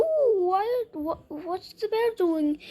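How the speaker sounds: lightly acted puzzled onlooker; curious wonder. Onlookers spotting the bear, curious wonder.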